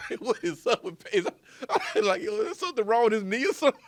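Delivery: high-pitched, giggling as you speak; talking through laughter. Real, not acted. Co-host joking about the quarterback's odd run, real laughing voice. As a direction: barely get the joke out for laughing.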